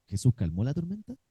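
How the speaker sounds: ordinary talk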